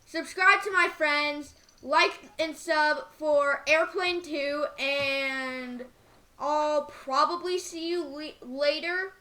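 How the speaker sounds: loud, slow outro to viewers; YouTube outro. Acted. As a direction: sign off to viewers, drawn out. Video outro.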